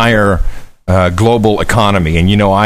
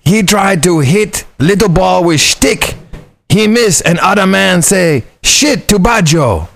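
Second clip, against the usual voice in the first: high, slow hammed-up Chinese accent, words broken and simple; mock Chinese accent; 5 semitones higher than usual. Nephew retelling Uncle Don's spy joke to cohost, hammed-up Chinese accent. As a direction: ham up a Chinese spy's report in a put-on Chinese accent. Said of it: "Just pretend it's Uncle Don with a good Chinese accent".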